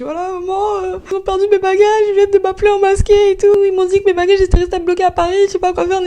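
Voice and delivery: high, fast, panicked voice to mom; phoning mom in a panic over lost luggage, high and frantic; panicked call home. Retold or imitated.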